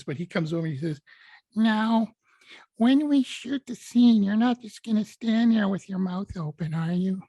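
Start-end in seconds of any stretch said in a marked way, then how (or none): 1.5-7.2 higher, slower Capote-style teasing lilt; Truman Capote voice. Retold or imitated. Hoffman as Capote teasing his co-star, arch high lilt. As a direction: needle the young actor, sweetly arch. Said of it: "I can't do Truman's voice".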